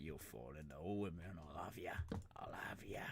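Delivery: soft, low pig mumble; lightly voiced pig. Player voices the pig taunting him, low murmur. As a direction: play a quietly insistent pig. Voices piggy in mock exchange.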